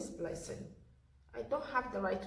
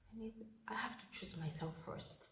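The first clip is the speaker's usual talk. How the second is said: whisper; 12 dB quieter than usual. Retold or imitated. Telling doctor she must choose herself.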